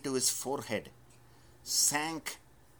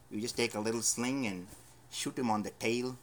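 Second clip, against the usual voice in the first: ordinary delivery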